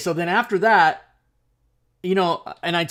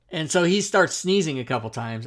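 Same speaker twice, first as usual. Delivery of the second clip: usual speaking voice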